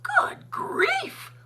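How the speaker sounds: high wailing groan; anguished high wail. A narrator's reading. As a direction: cry it out in dismay. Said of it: "groaned the ones who had stars".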